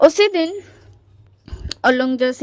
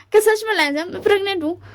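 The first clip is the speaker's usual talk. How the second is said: surprised; 6 semitones higher than usual. Retold or imitated. Wing shocked by pregnancy news.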